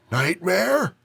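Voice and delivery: put-on high, coughing old man with Chicago accent; sick old man, Chicago accent. A narrator's reading. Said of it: "a cough talking with a heavy Chicago accent".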